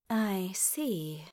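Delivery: soft, sighed acknowledgement; sighing. A narrator's reading. Dys answers the Elven King at the negotiation, sighing. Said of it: "Dys sighed".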